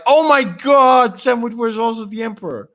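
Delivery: high, loud mock astonishment; hammed-up gushing amazement; amazed outcry. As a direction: imitate an awestruck fan, stretching the first exclamation. Acting out others' amazed reaction.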